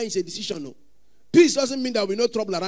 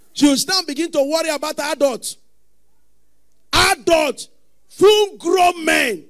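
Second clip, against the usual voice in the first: loud, high preaching climax; preaching at full force; 7 semitones higher, 9 dB louder, slower than usual. Acted. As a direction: build the sermon into a shout.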